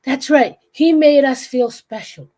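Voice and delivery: high, loud, dim-witted pride; Patrick voice. Retold or imitated. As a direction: say it proudly, missing the insult. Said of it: "And he's like".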